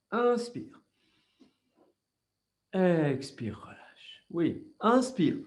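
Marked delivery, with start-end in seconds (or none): 3.6-4.2 breathy whisper on the exhale; whispered release. Shown as a demonstration. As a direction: let the word go out as air. Yoga breathing cue, exhale. (relâchement chuchoté)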